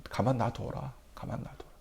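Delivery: calm them down gently, let it die away; hushed restraint. Retold or imitated. David quietly telling his generals to leave him, hushed.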